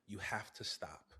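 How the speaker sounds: soft, low whisper; whispered warning. Real, not acted. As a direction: hush it like a secret truth. Solemn point about Odysseus.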